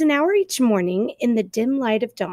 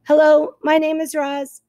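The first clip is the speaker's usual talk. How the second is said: higher, louder, even robot voice; robot greeting; 6 semitones higher, 4 dB louder than usual. Acted. Robot introduces herself to the animals, even robot voice. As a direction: announce yourself like a friendly machine. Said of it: "the robot spoke to the animals".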